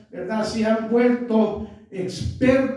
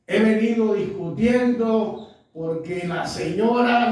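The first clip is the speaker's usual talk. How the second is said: usual speaking voice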